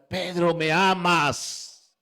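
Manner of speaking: loud, drawn-out appeal; insistent question. Acted. Jesus asks Peter for the third time, loud insistent question. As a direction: stretch each word out, calling it insistently.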